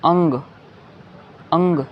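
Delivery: "अंग" with a nasal ng; "अंग" said alone, ending on ng; anusvara sound. Shown as a demonstration. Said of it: "इसे हम pronounce करते हैं".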